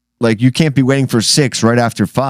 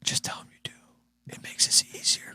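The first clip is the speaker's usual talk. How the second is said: whispered conspiratorial aside; 16 dB quieter than usual. Real, not acted. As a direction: keep it hushed between the hosts. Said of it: "Grayson's holding us hostage".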